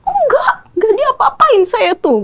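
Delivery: high, sharp retold yell at mother; a daughter's shrill denial, retold by her mother; retold snap. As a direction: imitate the daughter snapping back. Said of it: "Dia langsung bentak".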